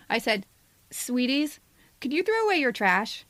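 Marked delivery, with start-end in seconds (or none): none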